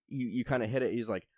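usual speaking voice

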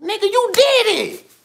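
busting the liar with a shout; calling him out. Acted. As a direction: snap it out as a gotcha.

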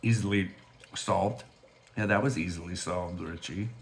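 talking around a hard candy; candy in mouth, words muffled; sucking a hard candy. Real, not acted. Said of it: "now I have candy in my mouth".

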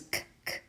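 short unvoiced k sounds, clipped off; teacher sounding out k; letter sound k. Shown as a demonstration. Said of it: "And the sound is".

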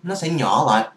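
ordinary talk, nothing marked

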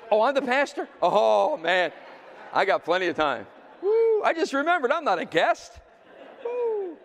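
high, loud, whooping fake realization; mock sudden delight. New pastor joking that he has plenty of time, mock delight. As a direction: play up the joyful surprise.

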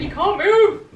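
high, loud playful command; mock warning shout. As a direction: bark it playfully. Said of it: "And I was like".

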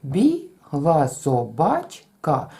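Бігла собачка slow and even; Бігла собачка in five beats; counted syllables. Shown as a demonstration. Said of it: "Рахуємо всі склади".

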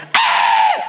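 a high, breathy screech like tyres braking hard; imitated car-brake screech. Comic imitating a car braking, high screech. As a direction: mimic the brakes locking up, loud and sharp. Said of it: "sem ouvir a travagem de um carro".

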